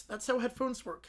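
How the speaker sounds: ordinary talk, nothing marked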